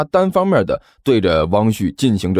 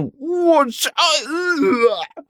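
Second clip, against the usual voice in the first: high strangled cry breaking into a gag; shriek, then choke on a dry heave; retching; much slower than usual. A narrator's reading. Landlord sees the horrifying scene outside, retching. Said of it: "干呕了一声".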